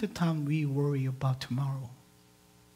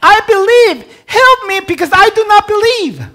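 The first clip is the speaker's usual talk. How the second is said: pleading cry; 19 dB louder than usual. Retold or imitated. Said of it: "That's what he's saying".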